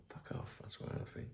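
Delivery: hushed muttered aside; whispered aside. Real, not acted. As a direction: hiss it as a private aside.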